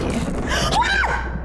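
shouting. Real, not acted. Scared outburst in horror game.

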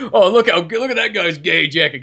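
lightly voiced mocking heckler; Russian joke voice. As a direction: jeer like a film character. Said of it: "Russian joke like that".

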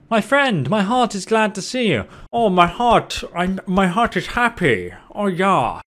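high-pitched mock clan leader. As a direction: put on a high, effusive voice for the chieftain. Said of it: "surely he doesn't talk like that".

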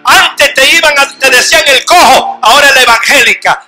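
loud, rising fervent sermon; preaching at full force. Acted. Preacher rousing the congregation, loud fervent preaching. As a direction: build the congregation up with every line.

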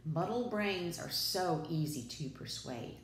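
ordinary delivery

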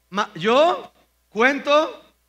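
loud, high, very slow recital; dramatic recitation. Shown as a demonstration. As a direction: drag out every word for the congregation. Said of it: "¿qué dice?". (recitación lenta, fuerte y aguda del salmo)

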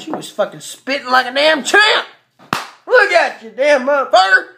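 loud, high cheering of the dog; excited praise. Real, not acted. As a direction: hype her up like a champ.

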